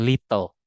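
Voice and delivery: T pronounced in little; little said "lit-tol" as the counterexample; little with full T. Shown as a demonstration. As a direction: keep the T in little.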